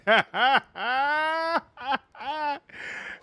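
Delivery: big high burst of laughter; loud laugh. Real, not acted. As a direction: cracks up loudly. Laughs at own goon bit.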